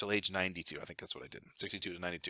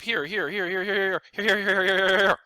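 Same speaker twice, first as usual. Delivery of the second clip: here after here, rising higher and louder; building excitement; 8 semitones higher, 8 dB louder than usual. Acted. Planner pointing at graph lines for viewers, building excitement. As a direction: rattle them off faster and louder.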